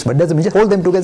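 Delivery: ordinary delivery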